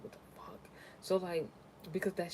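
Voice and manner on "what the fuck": breathy whispered disbelief; shocked whisper. Retold or imitated. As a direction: hiss it under your breath in shock.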